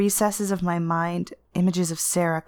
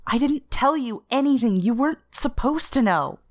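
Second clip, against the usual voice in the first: staccato outburst; 6 semitones higher than usual. Acted. Anissa blurts at the representative, staccato and raised. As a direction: bite each word off short. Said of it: "The words come staccato short".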